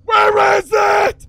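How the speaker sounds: very loud, high, drawn-out shriek; freaked-out scream. Real, not acted. Player hunting dog food in the basement, freaking out. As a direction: scream it slowly at the top of your voice. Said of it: "I'm freaking out".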